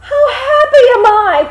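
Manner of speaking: high, loud shout of pure joy; crying out in delight; joyful cry. A narrator's reading. Hans crying out his happiness to the world, joyful shout. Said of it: "cried he".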